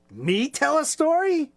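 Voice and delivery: high, delighted disbelief, lightly voiced; a child amazed to be asked; surprised little chicken.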